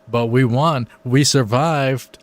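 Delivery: happy cheer. Real, not acted. Gamer celebrating the win, loud and drawn out. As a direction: savor the survival.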